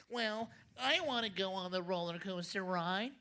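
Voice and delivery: lightly voiced nerdy girl; a hint of a nerdy little sister. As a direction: say it like a know-it-all kid. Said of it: "Beatrice kinda talks like a nerd".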